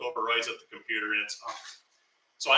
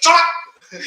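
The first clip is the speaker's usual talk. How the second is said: loud, high burst; retold sharp hushing; 10 dB louder than usual. Storyteller acts coworker silencing him at the keyboard, sharp and loud.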